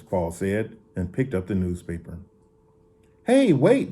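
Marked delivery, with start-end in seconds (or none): 3.2-3.9 high, urgent call after someone. A narrator's reading. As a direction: call after a departing friend, pitched high. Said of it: "Mario called to him".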